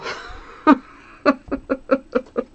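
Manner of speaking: high, loud burst of laughter. Real, not acted. Laughing at herself.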